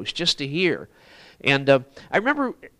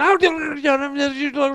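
mock rapid Russian; 11 semitones higher, 4 dB louder, much slower than usual. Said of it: "in rapid-fire Russian".

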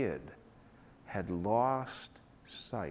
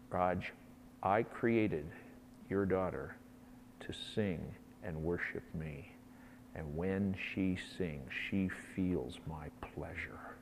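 softer and slower, ending in a whisper; soft voice of God; 4 semitones lower, 7 dB quieter, slower than usual. Retold or imitated. Preacher voicing God's whisper to him, soft and hushed. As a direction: hold it gently, like a secret. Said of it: "God whispered in my ear".

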